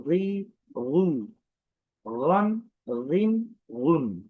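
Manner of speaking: রি, রু said slow and separate; teacher spells out each vowel form; slow vowel drill. Shown as a demonstration. Teacher drilling students on vowel forms, slow. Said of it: "আবারও বিভিন্ন উচ্চারণ বলছি".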